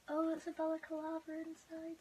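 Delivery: ordinary talk, nothing marked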